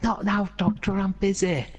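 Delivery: high-pitched, pestered scientist imitation; mock harried brush-off. Malcolm to the Doctor on the phone, mock harried.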